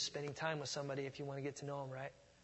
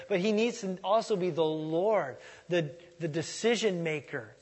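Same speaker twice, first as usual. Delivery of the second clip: preaching; 2 semitones higher, 8 dB louder than usual. Acted. Preaching emphatically.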